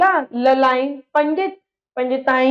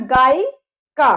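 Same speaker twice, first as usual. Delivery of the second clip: slow teaching demo; 4 dB louder, much slower than usual. Shown as a demonstration. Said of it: "स्त्रीलिंग क्या बना".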